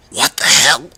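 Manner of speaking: loud, high shocked exclamation. A narrator's reading. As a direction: blurt it out in shock. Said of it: "or at least tried to say".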